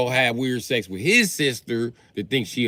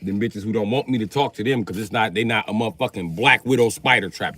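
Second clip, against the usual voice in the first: usual speaking voice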